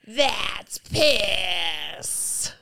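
stretched-out, strained mock wail; drawn-out meme quote. Dog-meme sound quoted to co-host, drawn-out cry. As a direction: blare it out slowly like the sound clip. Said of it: "And it's just going".

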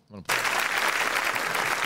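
slow whispered aside; real whispered aside. Real, not acted. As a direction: breathe it out slowly as you start the clip. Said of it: "Look, I'm gonna play it".

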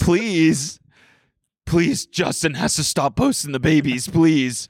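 begging. Acted. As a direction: play a PR agent begging him to stop. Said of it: "his P R agent begging".

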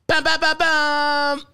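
high hype-man chant. Acted. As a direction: hype up the crowd like a DJ. Hype intro sound effect.

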